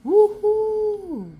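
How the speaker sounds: high, loud cheer of joy; cheering shout. A narrator's reading. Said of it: "they shout".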